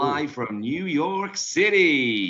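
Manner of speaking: slow, drawn-out American twang; American accent. Pretend. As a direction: ham up a New York host. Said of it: "I'm done with my terrible American accent".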